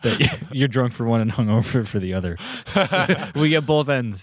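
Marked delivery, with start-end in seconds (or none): 2.4-3.3 bright laugh. Real, not acted. Laugh at hangover joke.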